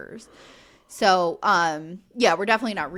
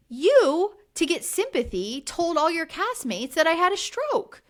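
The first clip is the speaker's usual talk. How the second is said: higher and louder, indignant accusation; retold furious confrontation, pitched up and loud; mock outraged Brittany; 8 semitones higher, 4 dB louder than usual. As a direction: throw the accusation back at him, voice climbing high. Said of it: "and she's like".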